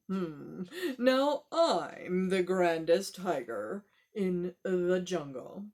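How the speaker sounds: tiger boast. Acted. Storyteller voicing a proud tiger, slow and low.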